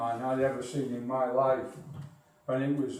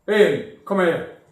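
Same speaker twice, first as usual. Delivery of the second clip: a father calling his son in, loud and higher; dad shouting; 4 semitones higher, 7 dB louder, slower than usual. Retold or imitated. Dad calling his son Ian, shouting. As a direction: bellow it through the house like a dad. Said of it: "the old fella shouts through".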